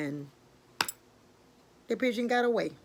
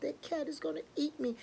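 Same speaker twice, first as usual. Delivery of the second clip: small, high, soft worried voice; frightened pigeon; 7 semitones higher, 5 dB quieter than usual. Acted. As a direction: fret quietly like a scared bird. Bird still fears the cat.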